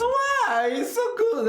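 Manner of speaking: very high, loud delighted objection; excited protest. Real, not acted. Reactor defends the French accent, high and delighted. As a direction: leap up in pitch defending it.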